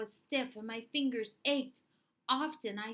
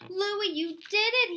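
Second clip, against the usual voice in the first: much higher, louder, slow amazed cry; joyful shout; 10 semitones higher, 8 dB louder than usual. Acted. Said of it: "he shouted".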